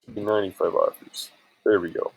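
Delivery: usual speaking voice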